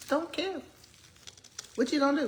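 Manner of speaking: tossed-off "What you gonna do?" with a lifted voice; shrugging defiance. Real, not acted. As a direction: throw it away like you don't care. Defiant about eating with hands.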